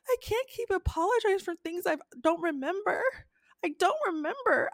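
high, whiny mock plea; whiny fiancee. Fiancee defending herself to Nathan, whiny and high. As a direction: whine it like a wronged princess. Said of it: "the fiance's like this".

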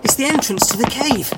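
panting hard between phrases; out of breath. Acted. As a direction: gasp it out after running from the captor. Fleeing, gasping on reaching cave.

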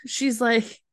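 ordinary talk, nothing marked

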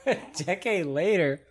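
laughing disbelief, pitch up; surprised. Real, not acted. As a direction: echo it back in amused disbelief. Incredulous echo of friend's words.

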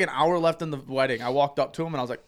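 ordinary talk, nothing marked

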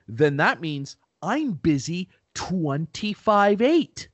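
slow, rising punchline delivery; embarrassing dad joke. Retold or imitated. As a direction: land the dad joke, drawn out. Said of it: "something my dad used to say".

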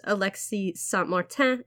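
Alexis Saint-Martin said the French way; French pronunciation. Shown as a demonstration. Host shows listeners the original name, French pronunciation. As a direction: say "Saint-Martin" as in French, with a nasal final vowel and silent n. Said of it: "my horrible French pronunciation".

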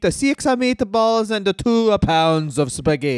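hammed-up Italian trainer, high at first; cartoon Italian lecture; mock Italian accent. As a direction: advise like a comic Italian coach. Trades Italian trainer impressions.

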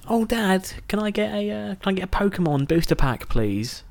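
put-on whiny eight-year-old asking for a treat; kid begging. Boy begging his dad for a booster pack, high pleading. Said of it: "I remember saying to my dad".

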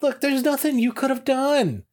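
a hint of a sympathetic dancer; voicing Dottie. Retelling the Munsters episode, a lightly voiced consoling Dottie.

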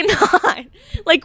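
ordinary talk, nothing marked

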